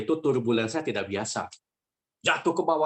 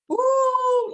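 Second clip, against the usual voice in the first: high-pitched mock scream of panicked passengers; 4 dB louder than usual. Preacher imitating adults as the plane plunges, screaming. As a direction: imitate grown-ups screaming as the plane drops. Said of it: "orang dewasa pada teriak".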